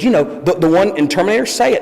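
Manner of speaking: eager, rising to a high plea; insistent fan. Retold or imitated. Fan prompting the actor about Terminator, insistent and high. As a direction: imitate a fan pressing for it, pitch climbing. Fans pressing the star.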